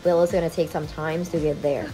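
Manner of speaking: usual speaking voice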